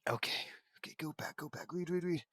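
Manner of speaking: whispered self-reminder; softly urging yourself to move on. Real, not acted. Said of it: "whispered to self".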